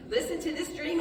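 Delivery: high, eager boast about the dream; boastful excitement. Retold or imitated. Brother boasting to brothers, high eager voice. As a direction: Joseph showing off to his brothers, excited and boastful. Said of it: "as if that's a good idea".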